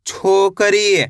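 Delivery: slow teacher model of छोकरी; very slow blend. Shown as a demonstration. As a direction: stretch छोकरी out slowly, syllable by syllable merged. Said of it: "teacher blends syllables very slowly".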